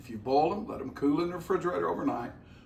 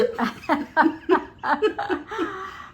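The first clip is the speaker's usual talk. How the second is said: high, loud burst of laughter; hearty laugh; 6 dB louder than usual. Real, not acted. Laughing at his own joke.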